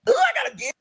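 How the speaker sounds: high delighted squeal; girlish excited gush. Retold or imitated. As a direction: squeal with joy over a gift. Squeal over gift.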